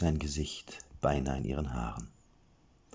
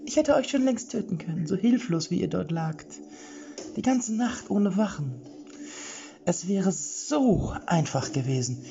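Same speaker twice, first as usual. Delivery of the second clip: much higher, menacing reedy voice; gloating villain falsetto. A narrator's reading. Chica Vollbart gloats over the sleeping group in a high falsetto. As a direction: boast about how easily he could have killed them.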